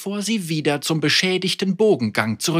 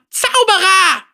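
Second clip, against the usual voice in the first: hoch und empört berichtigt; pikiert korrigierend; 6 dB louder than usual. Acted. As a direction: scharf verbessern. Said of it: "korrigierte Vega sie". (pikierte Zauberer-Stimme)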